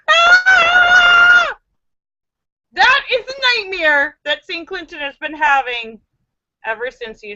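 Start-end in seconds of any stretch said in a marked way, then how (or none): 0.1-1.6 sudden shrill high scream; mock scream. Host screaming as the frightened dreamer, shrill high scream. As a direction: scream as if waking from a nightmare.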